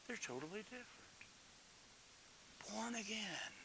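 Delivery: breathy whispered awe. Real, not acted. Preacher to congregation, a reverent whisper. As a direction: whisper it like a sacred secret.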